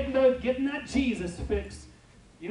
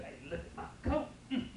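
smug sheep; 7 dB quieter than usual. Retold or imitated. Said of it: "the sheep thinks it's pretty good".

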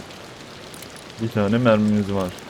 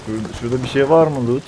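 usual speaking voice

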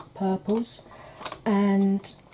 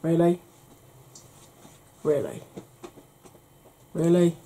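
underwhelmed reaction; 2 semitones lower than usual. Retold or imitated. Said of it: "it's like".

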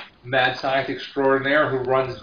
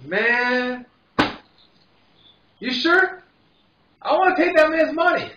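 put-on much higher drunk whine; wheedling drunk; 13 semitones higher, slower than usual. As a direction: beg for the bet. Drunk pleading.

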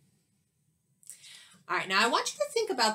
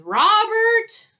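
loud, much higher furious yell; teacher yelling; 11 semitones higher, 10 dB louder than usual. Acted. Teacher yelling at Bobby over a paper airplane, furious. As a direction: bellow it at the naughty boy. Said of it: "she says".

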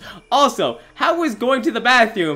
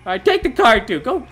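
ordinary talk

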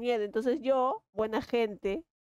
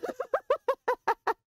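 a high burst of laughter. Real, not acted. Laughing after mock panic. (carcajada aguda)

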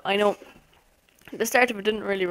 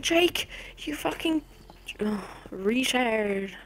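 mock viewer shouting; slower than usual. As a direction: imitate an exasperated viewer without real volume. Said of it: "like, shouting at me saying".

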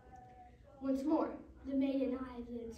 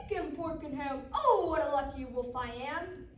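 loud wolf, swelling on the "oh"; triumphant storybook wolf cry; gleeful wolf shout; 7 dB louder than usual. Acted. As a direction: shout it out, drawing out the boast. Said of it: "He shouted".

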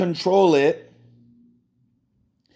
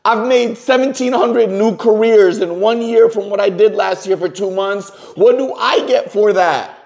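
higher and louder, bursting with frustration; agitated outburst; 7 semitones higher, 3 dB louder than usual. Real, not acted. Upset caller venting to Susie over the phone, agitated and loud. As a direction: vent it like it's been bottled up all year.

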